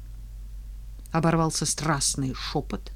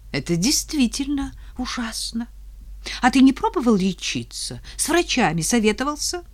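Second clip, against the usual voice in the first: higher, sweetly sympathetic; 7 semitones higher than usual. Acted. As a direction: switch to fake sympathy. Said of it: "вдруг она сменила тон".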